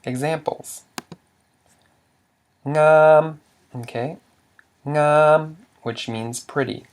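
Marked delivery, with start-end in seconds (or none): 2.6-3.4 NGAM held on a high level tone; long, level high pitch on Ngam. Shown as a demonstration. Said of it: "equals a high tone". 4.9-5.6 ngam held high and level; long high-tone ngam. Shown as a demonstration. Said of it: "which equals a high tone".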